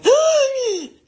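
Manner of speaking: loud startled gasp; mock nightmare gasp. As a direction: jolt awake with a sharp gasp. Boyfriend wakes from nightmare.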